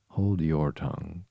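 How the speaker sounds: ordinary delivery, nothing marked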